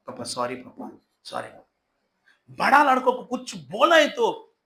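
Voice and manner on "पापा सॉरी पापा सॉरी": meek apology. Acted. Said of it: "छोटा लड़का को पापा हमेशा डांटता था".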